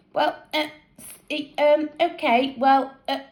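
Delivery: nervous halting stammer. A narrator's reading. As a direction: stumble over each word shyly. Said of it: "stammered Colleen".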